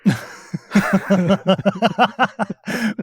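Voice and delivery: long rolling laugh. Real, not acted. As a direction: laugh it off warmly. Laughing at yawn remark.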